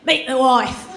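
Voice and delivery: lightly put-on Cockney; a hint of a gruff Brit calling out; mild mock Cockney husband; British husband. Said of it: "it's more".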